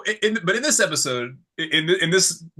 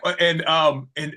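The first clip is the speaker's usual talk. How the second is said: ordinary talk, nothing marked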